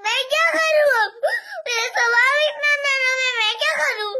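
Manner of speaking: helpless tearful wail; real crying, overwhelmed with worry. Real, not acted. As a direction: sob out your worry to mother. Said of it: "इसे रोना नहीं।".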